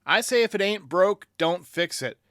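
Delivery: usual speaking voice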